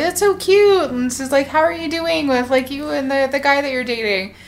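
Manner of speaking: high-pitched gushy coo; mock-sweet, high gushing; gushing friend. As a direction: imitate a friend cooing, sliding down from high. Friend cooing over baby news.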